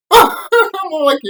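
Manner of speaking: big burst of laughter into the words; laughing out loud at his own joke. Real, not acted. Vlogger cracking up at his own joke, loud laughing.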